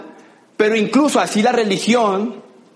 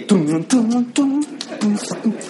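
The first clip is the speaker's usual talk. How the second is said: slow, dragged-out bass thumps; slow mouthed beat; much slower than usual. Retold or imitated. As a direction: let the beat drag as he keeps going. Drunk man keeps partying. (bombo lento imitado con la boca)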